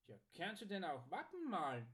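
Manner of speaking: surprised customer voice. A narrator's reading. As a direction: ask in swooping surprise. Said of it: "fragte Archibald überrascht".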